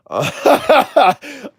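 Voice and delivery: high-pitched burst of laughter; real laugh, pitched well up. Real, not acted. Laughs after admitting he's cursing.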